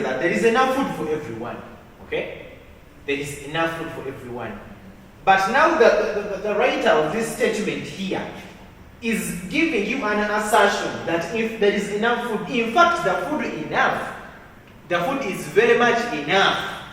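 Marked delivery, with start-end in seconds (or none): 5.2-16.8 emphatic teacher. Shown as a demonstration. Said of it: "teacher stresses the assertion".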